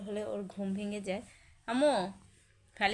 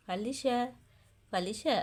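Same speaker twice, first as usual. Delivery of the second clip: normal talking voice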